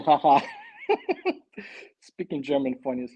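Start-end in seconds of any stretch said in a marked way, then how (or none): 0.4-1.8 a squealing high-pitched laugh. Real, not acted. Amused by his own German voice, squealing laugh. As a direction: burst into a giddy squeal of laughter.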